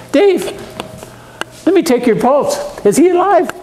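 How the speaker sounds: high, playful ribbing; joking tease. Pretend. Pastor teasing Dave in the pews, high playful ribbing. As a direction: poke fun like checking a patient. Joking about Dave's pulse.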